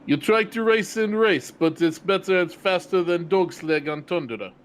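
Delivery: loud, exaggerated Russian accent. Shown as a demonstration. Host showing his bad Russian accent to chat, in a Russian accent. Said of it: "is Russian".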